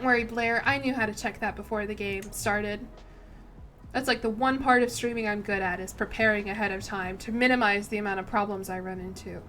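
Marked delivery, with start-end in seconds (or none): none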